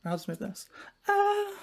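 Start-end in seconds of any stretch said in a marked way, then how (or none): 1.1-1.5 sudden high, loud "ah"; startled cry. Real, not acted. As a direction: yelp out in a high pitch. Tugging at stuck hair.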